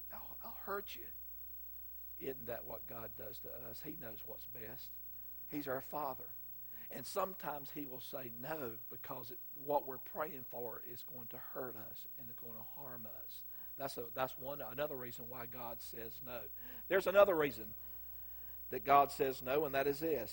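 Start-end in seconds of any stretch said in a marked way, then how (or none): 0.1-1.1 hushed, breathy warning; whispered warning. Retold or imitated. Friend refuses to pass poison. 16.9-20.3 the same point, now preached louder; louder restatement. Real, not acted.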